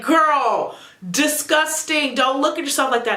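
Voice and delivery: high, loud sassy scold; a friend scolding the screen. Retold or imitated. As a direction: tell her off with attitude. Scolding the character.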